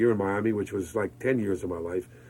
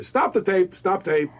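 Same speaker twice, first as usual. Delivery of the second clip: retold call to stop; 8 semitones higher, 4 dB louder, slower than usual. Retold stopping the prompter tape.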